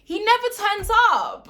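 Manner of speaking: loud, high, heated rant about a player; angry rant. Real, not acted. Fan raging at Jorginho on his channel, loud and heated.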